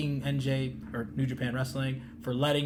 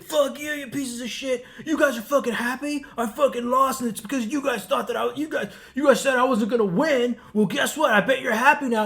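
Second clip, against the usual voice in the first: higher, louder mock rant; imitating furious wrestler; 8 semitones higher, 7 dB louder than usual. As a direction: channel a bloodied wrestler cursing the crowd. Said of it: "he's like".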